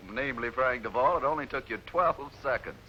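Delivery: ordinary talk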